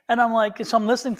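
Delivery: usual speaking voice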